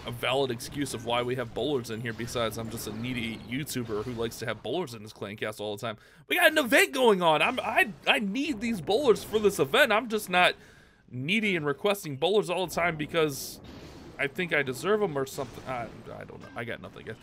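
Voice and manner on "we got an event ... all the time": higher, louder, mock-defensive protest; mock rant. YouTuber defending his bowler requests in a higher, louder mock rant. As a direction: whip up a fake indignant rant. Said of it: "That's enough of that rant".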